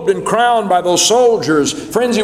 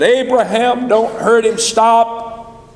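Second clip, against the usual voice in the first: much higher, urgent angel's voice calling from above; angel crying out; 8 semitones higher than usual. Acted. God's angel calling to Abraham to stop, high and urgent. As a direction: call out to stop the knife, then proclaim. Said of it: "the voice of God's angel cried out".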